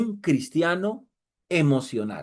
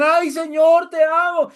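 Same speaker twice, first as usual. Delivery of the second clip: high, loud mock wail; hammed-up shout of adoration; imitated worship cry; 11 semitones higher, 7 dB louder, slower than usual. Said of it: "Y gritan".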